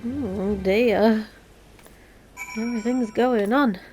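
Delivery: normal talking voice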